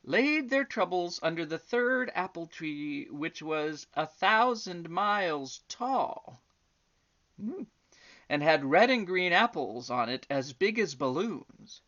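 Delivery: put-on gentle storybook reading for children; story narration. A narrator's reading. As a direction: read it like a bedtime tale. Reads a bedtime story aloud.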